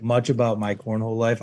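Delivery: ordinary talk, nothing marked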